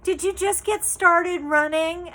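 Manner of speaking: mock the clerk's condescending tone; imitating a condescending store clerk; patronizing. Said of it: "it was just so patronizing".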